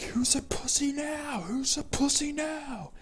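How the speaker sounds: high, hushed imitation of yelling; stage-whispered taunt, drawn out and high; mock yell. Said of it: "I was just kinda yelling at him".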